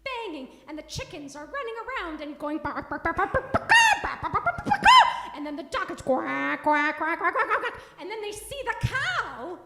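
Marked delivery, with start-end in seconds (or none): 2.9-4.8 loud, rising barks; loud barking. Acted. Storyteller barking for chickens gone crazy in the house. 6.0-7.8 loud quacks with a laugh. Acted. Storyteller laughing while quacking for the mixed-up dog.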